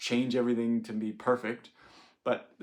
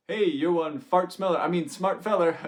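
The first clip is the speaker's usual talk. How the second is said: loud, high playful grandpa pun; hammed-up joking grandpa; grandpa's corny pun; 7 semitones higher, 7 dB louder than usual. Grandpa teasing clever grandkid with a pun, playful loud.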